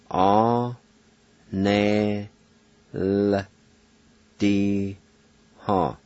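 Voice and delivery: Ah, ne, l, d, ha with the I silent after the L; Cherokee pronunciation. Shown as a demonstration. Teacher showing learners the silent I after the L. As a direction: sound out Ah, ne, l, d, ha, holding the bare L. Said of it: "pronounce the L sound like this".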